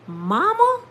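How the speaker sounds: high, loud rising question; stunned disbelief. Retold or imitated. Daughter hearing her mother out of coma, loudly astonished. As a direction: cry it out as if you can't believe who it is.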